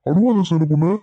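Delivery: high-pitched cooing to an upset wife; fussing over a crying sweetheart, pitched high; doting coaxing. Acted. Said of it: "நீ சின்ன பொண்ணு செல்ல கண்ணுன்னு கொஞ்சினது".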